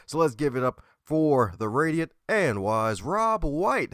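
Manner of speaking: rising, high-pitched MC welcome; hyped guest introduction. Acted. Host brings on today's guest, high hyped-up announcer welcome.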